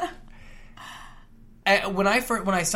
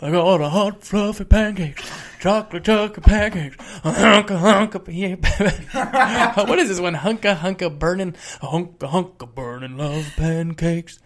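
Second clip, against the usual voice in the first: loose [name] impression, louder; 3 dB louder, slower than usual. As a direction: croon it like a hammy [name]. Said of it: "Why don't you do an [name] voice".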